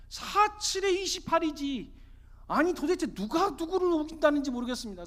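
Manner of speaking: high, loud incredulous pleading; exasperated protest. Acted. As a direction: argue your case in disbelief. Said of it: "너무 어이가 없어가지고". (어이없다는 듯 높은 소리로 항변)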